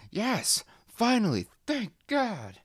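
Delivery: very high, squeaky cry of relief; a tiny cup voice rejoicing at being heated; the cup in the microwave celebrating, very high and squeaky; relieved coffee cup. Acted.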